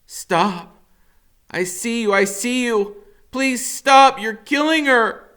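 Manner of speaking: high, desperate yelling, pleading; a boy screaming in panic for him to let her go; frantic screaming. Acted. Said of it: "I'm going".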